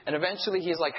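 ordinary talk

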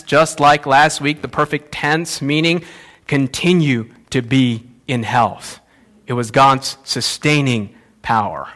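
ordinary delivery

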